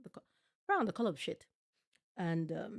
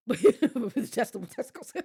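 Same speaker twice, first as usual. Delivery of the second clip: laughing through the words, louder; cracking up at her own pun; 5 semitones higher, 10 dB louder than usual. Real, not acted.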